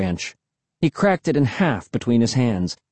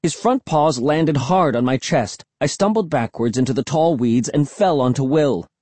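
usual speaking voice

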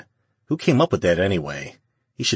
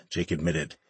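plain reading voice

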